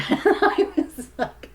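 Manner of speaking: laughing through the words; breaking into laughter mid-sentence. Real, not acted. Vlogger laughing at viewer videos, laughing.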